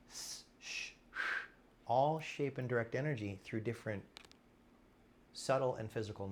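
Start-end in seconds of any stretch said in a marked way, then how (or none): 0.1-1.2 S, sh, sh hissed breathily; whispered s and sh sounds. Shown as a demonstration. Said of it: "So it matters".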